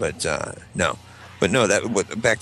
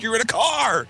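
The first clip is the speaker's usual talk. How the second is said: high-pitched wailing protest; hammed-up helpless complaint; mock exasperation; 14 semitones higher than usual. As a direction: imitate a kid pleading unfairness. Kid protesting dad's impossible order.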